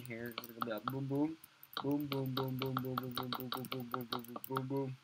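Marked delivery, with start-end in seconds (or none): none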